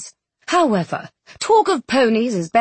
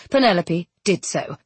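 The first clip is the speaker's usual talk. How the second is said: usual speaking voice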